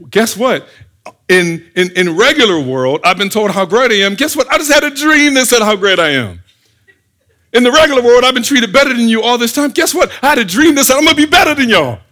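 excited, high-pitched bragging at full tilt; boastful bragging. Acted. Joseph boasting to his brothers about his dream, high loud brag.

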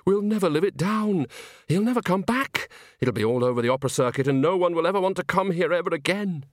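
high, plaintive lament; moaning despair. Acted. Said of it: "moaned Bucket".

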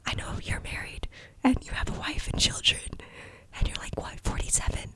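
breathy whisper to someone who isn't there; teasing whisper. Pretend. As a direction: whisper it like a cheeky secret. Teasing aside to Cillian Murphy.

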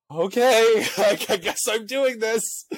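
a hammed-up nervous okay, then laugh; nervous resignation. Retold self, resigned to run.

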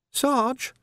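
put-on an eager young constable; youthful lad's voice; young Sam. A narrator's reading.

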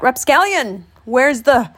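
hollering. A narrator's reading. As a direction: holler as if flagging someone down. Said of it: "hollered out".

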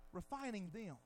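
soft, low repeat; hushed afterthought; quiet echo. Real, not acted. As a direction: let it fall to a hush. Echo after shout, dropped low.

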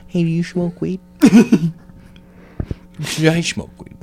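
lightly voiced undercover cop; mock narc. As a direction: quietly play a narc. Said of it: "You sound like a fucking narc".

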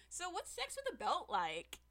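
high, slow, teasing question; mock coy. Lawyer toying with the witness in cross-examination, coy. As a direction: play coy, drawing it out high. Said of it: "like, twirling a lock of hair around his finger".

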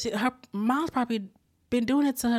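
ordinary delivery

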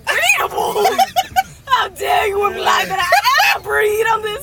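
shrieking through laughter, slow; outraged laughing shout. Real, not acted. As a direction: howl in mock offence. Mock outrage at a joke.

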